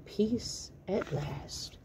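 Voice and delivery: slow, whispered relief; relieved whisper. Acted. Dad whispers, slow and relieved, enjoying the peace. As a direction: savor the quiet. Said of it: "Dad relieved".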